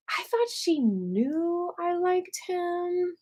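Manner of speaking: high, slow, drawn-out whine; mock wailing. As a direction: wail it slowly like a heartbroken kid. Hurt younger self in retold story.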